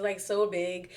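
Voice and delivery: ordinary delivery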